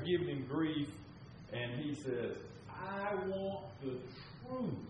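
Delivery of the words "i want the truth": slow, high, drawn-out demand for the truth; movie lawyer demand. Retold or imitated. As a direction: press the witness like the film's lawyer.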